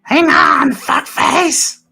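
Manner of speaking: tiny squeaky high voice; high little pixie shout. Acted. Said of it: "tiny little voice".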